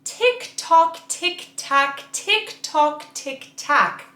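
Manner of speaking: crisp tick tock drill, consonants snapped; articulation drill. Shown as a demonstration. Vocal coach demonstrating a crisp drill. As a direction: spit out each t and k cleanly. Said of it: "The first combination of sounds you're going to make is this".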